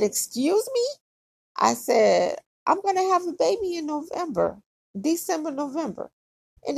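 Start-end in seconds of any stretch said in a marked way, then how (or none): 0.0-0.9 much higher, mock-startled dad voice; a hammed-up father's squeaky disbelief. Dad reacting to baby news. 2.7-6.1 higher and fast, playful excited rush; excited announcement. Retold or imitated. Telling dad the news.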